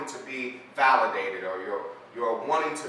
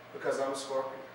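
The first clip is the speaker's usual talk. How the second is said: calm reply; 6 dB quieter, faster than usual. Acted. Scorpion answers the drowning frog.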